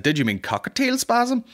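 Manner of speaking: high, prim computer voice; lightly voiced search engine; mock search engine. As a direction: read the correction like a smug search box.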